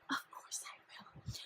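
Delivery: soft breathy whisper. Acted. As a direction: whisper it like a secret. Sudden whisper aside.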